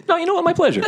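high-pitched, gleeful acceptance; gleeful reply. Acted. Killer deciding the thanks is deserved, high gleeful reply. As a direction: own it in a high, amused voice.

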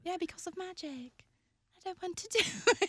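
put-on high, small, sulking kid; acted upset child. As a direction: play a high-voiced kid refusing magic. Voices a child arguing with parent.

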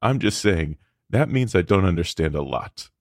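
ordinary delivery

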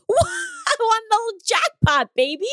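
high, slow jackpot cheer; gleeful mock celebration; excited. Exaggerated joyful exclamation.

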